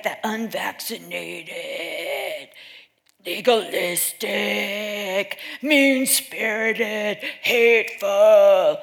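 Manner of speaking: mocking labels. Speaker mimics how media labels Christians, drawn-out mockery.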